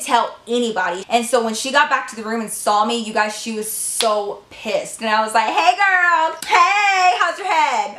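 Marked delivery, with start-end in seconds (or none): 5.5-8.0 fake sweet taunt. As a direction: greet her with syrupy fake friendliness. Taunting Lisa in the room.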